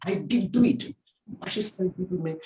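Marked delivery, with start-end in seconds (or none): 0.1-0.8 raised, emphatic "did do it"; DID pitched up, falling to "it"; stress on DID. Retold or imitated. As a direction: quote it insistently, leaning on "did". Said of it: "emphatically saying".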